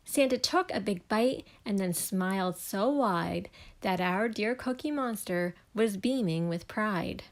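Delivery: plain reading voice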